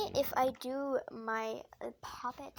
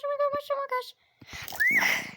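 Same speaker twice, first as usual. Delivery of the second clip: high, louder, slowed squeal of suspense; nervous squeal; 8 dB louder, slower than usual. Real, not acted. Vlogger holding her breath over the stack, slow nervous squeal.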